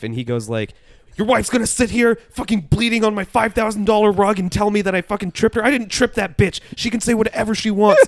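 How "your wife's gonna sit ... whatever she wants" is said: high, very loud indignant rant; angry yelling. Retold or imitated. Host yells back at the accusing husband, high mock-furious shouting. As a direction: yell it all out in hammed-up outrage.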